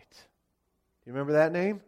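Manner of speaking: usual speaking voice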